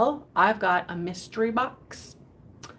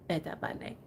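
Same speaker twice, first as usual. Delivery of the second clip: whispered; 3 semitones lower, 11 dB quieter than usual. Shown as a demonstration. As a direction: repeat it in a breathy whisper.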